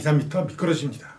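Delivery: ordinary talk, nothing marked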